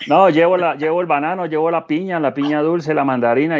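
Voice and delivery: mock hawker's rolling list; fruit vendor call. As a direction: imitate a fruit seller chanting wares over a loudspeaker. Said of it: "pasa un vendedor de fruta".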